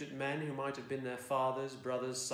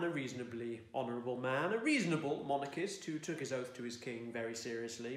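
plain reading voice

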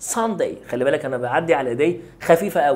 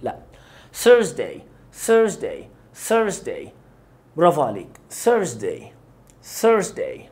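ordinary talk, nothing marked

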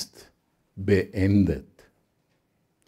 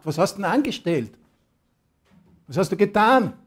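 higher, louder, demanding questions; indignant Pilate; 6 semitones higher, 5 dB louder than usual. Acted. Pilate interrogates Jesus, indignant, higher and louder. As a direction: Pilate rounding on Jesus, loud and high.

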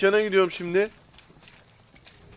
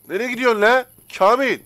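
loud shout after someone leaving, voice higher; calling out; 5 semitones higher, 7 dB louder than usual. Real, not acted. Gamer yelling after a customer who is leaving without paying, loud call. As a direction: yell across the cafe at a customer who is walking off.

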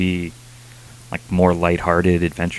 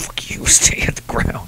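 mock bully; 4 dB quieter, slower than usual. Retold bully, whispered mean voice.

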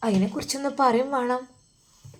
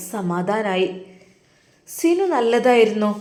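usual speaking voice

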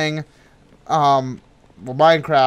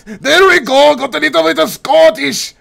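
high, hammed-up Scottish accent; bad Scottish accent; 9 semitones higher, 4 dB louder than usual. Co-host shows off doing Scottish, exaggerated Scottish accent. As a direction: put on a broad, terrible Scottish accent. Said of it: "do Scottish".

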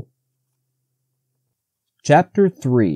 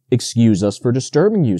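ordinary delivery, nothing marked

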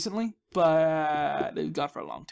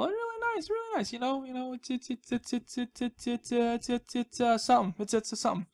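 silly high voice; 5 semitones higher than usual. Pretend. Showing viewers the chill room, silly high stammering voice. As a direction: squeak it out high and dithering. Puts on voice after fun guy.

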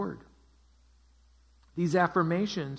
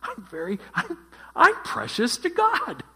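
much higher and louder, hammed-up gushing pride; mock proud delight; 9 semitones higher, 6 dB louder than usual. Pastor voicing a listener's proud reaction to the angel, hammed-up gushing delight. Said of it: "Jenny said she'd feel proud".

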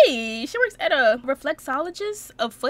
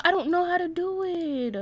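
usual speaking voice

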